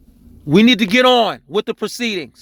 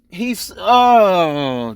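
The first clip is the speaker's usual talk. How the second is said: long falling groan, lost for words; exasperated groan. Real, not acted. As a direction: break off into a frustrated groan. At a loss with exasperation.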